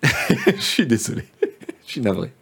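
apologizing through laughter; giggling while saying sorry; cracking up mid-line. Real, not acted. Laughs at his own blunder. (fou rire en s'excusant)

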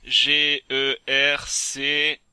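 lettre par lettre, très lentement; détache chaque lettre, lentement et plus fort; épeler lentement. Shown as a demonstration. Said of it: "Ah, épelle-le". (épellation lente et appuyée)

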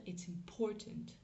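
English "important" stressed on POR; accent tonique au milieu. Shown as a demonstration. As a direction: hit the middle syllable of "important", English style. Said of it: "l'accent tonique est sur cette syllabe-là". (« important » à l'anglaise, accent sur la syllabe du milieu)

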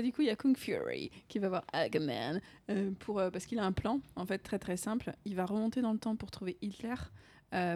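usual speaking voice

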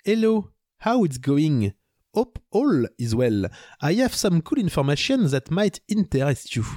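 put-on slow English read in a thick French accent; clumsy French-accented English, drawn out. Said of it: "vous pardonnerez, euh, mon accent".

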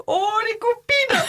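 high, gushing shout-out to friends; excited greeting. Acted. Hairdresser greeting salon friends, excited. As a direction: squeal hello to the girls.

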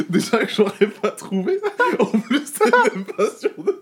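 talking through a burst of laughter; cracking up while teasing; high laughing protest. Real, not acted. Mocking friend, cracking up.

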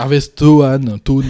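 Harvest Town with a put-on English accent, stress on Town. Shown as a demonstration. Asked to repeat it, says Harvest Town in an English accent. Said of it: "Oh, redis-le".

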